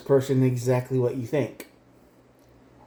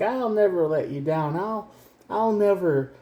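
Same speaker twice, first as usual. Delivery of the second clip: higher, earnest promise; mock earnest vow; 7 semitones higher than usual. Retelling your own vow to the partner, higher. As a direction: swear it like a devoted partner. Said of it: "you might have made promises to this person, like".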